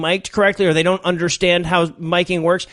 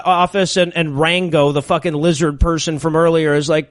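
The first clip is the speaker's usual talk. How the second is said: ordinary delivery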